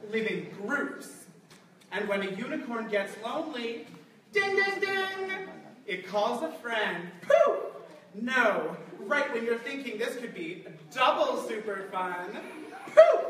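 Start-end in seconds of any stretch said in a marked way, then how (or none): 4.3-5.3 high chiming ding ding ding; sing-song chime. Acted. Reading a magic sound effect. 7.2-7.6 high, loud burst of magic; loud poof. Acted. Reading magic appearance sound. 12.9-13.2 high, loud burst of magic; loud poof. Acted. Reading magic appearance sound.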